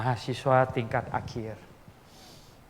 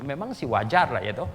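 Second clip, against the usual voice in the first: ordinary talk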